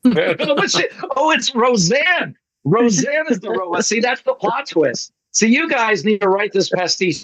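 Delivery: high, laughing burst of glee; thrilled by a new plot twist; excited delight. Real, not acted.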